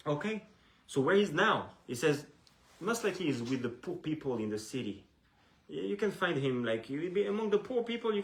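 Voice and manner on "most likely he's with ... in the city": ordinary delivery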